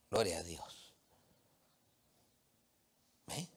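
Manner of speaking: ordinary talk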